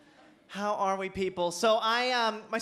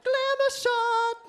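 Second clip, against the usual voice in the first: high glamour announcement; 4 dB louder, slower than usual. Acted. Announcing the pose punchline.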